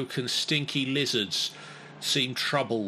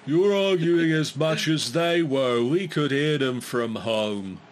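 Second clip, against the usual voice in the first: scolding monster voice; 4 semitones higher, 5 dB louder than usual. Acted. Monster head scolds the party.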